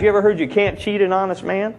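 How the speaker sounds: ordinary talk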